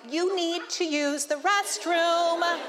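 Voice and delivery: high, slow mock voice of her body; hammed-up nagging body voice, drawn out. Speaker voicing her own body urging a bathroom break, high and slow. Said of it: "'cause my body is like".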